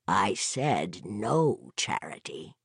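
low, slow, quiet and final; cold refusal. A narrator's reading. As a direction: refuse her slowly, enjoying it. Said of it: "he was enjoying this".

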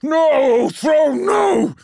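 very high, loud screamed denial; escalating anguished shouts; horrified cry. Acted. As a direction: scream it in torment. Magnus cries out in horror.

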